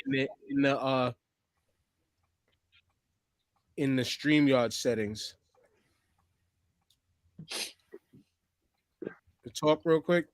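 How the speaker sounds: distant, off-mic voice; far from mic. Real, not acted. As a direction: sounds like the back of the room. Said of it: "you still sound like you're fucking back of the room".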